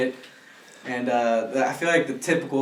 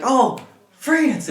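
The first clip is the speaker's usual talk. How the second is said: pitched higher, a quick bright guess; girl's confident guess; 10 semitones higher, 3 dB louder than usual. Retold or imitated. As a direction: answer like the girl, voice up high. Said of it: "And she's like".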